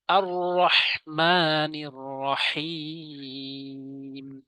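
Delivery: slow, drawn-out chanted recitation; Quran recitation. Shown as a demonstration. Teacher recites the verse for viewers, slow and chanted. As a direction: stretch each long vowel like tajweed. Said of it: "হাটা চাপ দিয়া রহিম".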